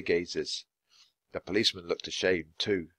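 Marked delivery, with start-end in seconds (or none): none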